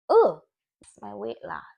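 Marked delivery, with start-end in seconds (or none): 0.1-0.4 loud, high exasperated groan; frustrated ugh. Real, not acted. Vlogger fumbling words, groaning in frustration. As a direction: groan at yourself for tripping up.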